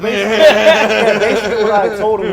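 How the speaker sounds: laughing through the words. Real, not acted. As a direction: talk while still laughing. Laughing while talking.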